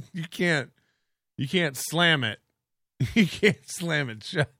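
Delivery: ordinary talk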